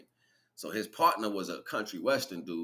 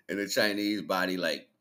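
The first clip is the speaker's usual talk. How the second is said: normal talking voice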